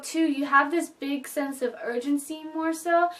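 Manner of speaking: ordinary delivery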